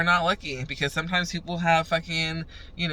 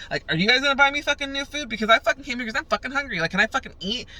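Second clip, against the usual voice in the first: fast, high-pitched indignant complaint; real upset; 8 semitones higher, faster than usual. Retold or imitated. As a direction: rattle off the grievance at the cops, pitch raised. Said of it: "I was getting real upset".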